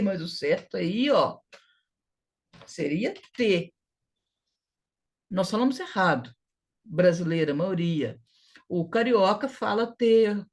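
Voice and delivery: ordinary delivery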